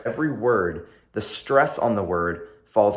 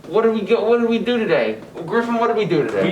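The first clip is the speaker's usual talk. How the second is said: much higher, eager quiz voice; excited question; 11 semitones higher, 3 dB louder, faster than usual. Real, not acted. Host quizzing Griffin on the lesson, excited and high. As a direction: ask it like a game show host.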